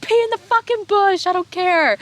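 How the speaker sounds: very high, loud mock outburst; retold exasperated yell. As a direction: screech back at friends, hammed-up. Retold angry reply to friends.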